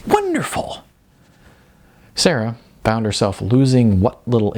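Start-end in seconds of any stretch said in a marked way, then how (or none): 0.1-0.8 a sudden, much higher burst of delight; overjoyed squeal of praise; excited interjection. Acted. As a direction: gush the word out like a thrilled coach. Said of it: "Bobby interjected with a".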